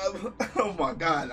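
ordinary talk, nothing marked

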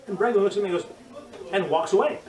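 ordinary delivery

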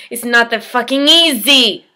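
loud, bitter burst; angry retort. Acted. Patient answers those calling suicide easy, loud and angry. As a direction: throw it back hard at those people.

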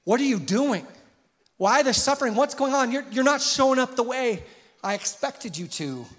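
high, loud, pleading questions to God; anguished plea to God. Retold or imitated. Disciple crying out to Jesus, anguished and pleading. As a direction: cry out to God, hurt and confused. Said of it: "looking up at Jesus and going".

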